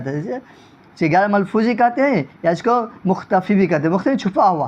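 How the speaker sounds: usual speaking voice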